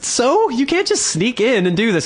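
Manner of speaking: indignant. Retold or imitated. Arguing with queue jumper.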